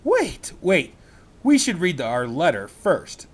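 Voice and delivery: put-on a boy slowing his sister down; Jack holding back. Jack stopping Annie.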